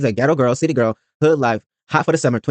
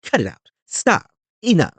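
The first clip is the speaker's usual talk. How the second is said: scolding; 4 semitones higher, slower than usual. Real, not acted. Telling Portia off.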